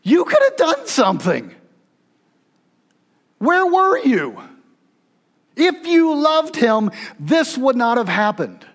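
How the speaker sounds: high, slow pleading reproach; grief-stricken accusation, high and urgent; accusing cry. Acted. Grieving sister's accusing quote.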